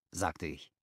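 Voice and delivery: ordinary delivery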